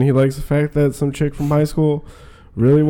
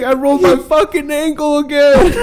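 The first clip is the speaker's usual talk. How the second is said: mocking whine; 12 semitones higher, 4 dB louder than usual. Imitating drunk friend's complaint.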